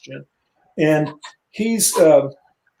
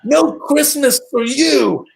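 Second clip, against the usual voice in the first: high, slow, mock-stern decree; mock Soup Nazi; 10 semitones higher, slower than usual. Sebastian throwing the driver's stocking in the trash, slow mock decree. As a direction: deliver it like a gleeful punchline. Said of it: "and he goes".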